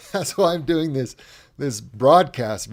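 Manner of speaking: emphatic. Real, not acted. Restarts the line, punching it.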